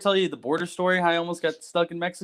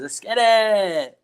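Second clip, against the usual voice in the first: cousin's catchphrase; 5 semitones higher than usual. Retold or imitated. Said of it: "She loves saying, she says".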